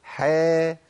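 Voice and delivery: "حا" with a thin, smiled front vowel; thin held "hae" for "حا"; light ha, smiling. Shown as a demonstration. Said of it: "تحقيق الترقيق في حروف الترقيق بابتسامة".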